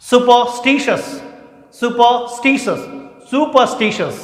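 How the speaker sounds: superstitious drawn out slowly, syllable by syllable; model pronunciation. Shown as a demonstration. Teacher modelling the word for students, slow and clear. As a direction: stretch out superstitious for the class to copy. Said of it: "Repeat after me".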